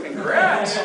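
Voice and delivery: high, loud burst of congratulation; a bright, delighted shout for the lucky ticket holder; excited congrats. Real, not acted. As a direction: cheer the winner on. Announcing a raffle winner.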